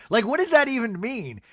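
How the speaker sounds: ordinary talk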